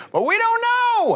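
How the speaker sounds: very high, slow, squeaky mock voice; mock whiny insistence. Mocking relatives insisting they don't know, high slow whine. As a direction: drag it out in a hammed-up whine. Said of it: "mocking relatives' reply".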